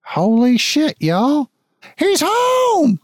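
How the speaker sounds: rising from high to very high in shock; a guard who can't believe his eyes; excited guard. Acted. Guard recognizing the returning son, high excited shout. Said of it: "He's like".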